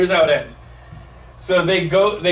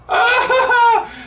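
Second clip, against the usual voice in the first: retold yelp; 4 dB louder than usual. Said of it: "I'm like".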